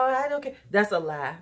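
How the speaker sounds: ordinary talk, nothing marked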